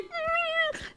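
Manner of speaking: high, soft strangled whimper; stifled choking noise. Retold or imitated. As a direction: mime a blocked throat with a tiny squeal. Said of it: "I do the same thing".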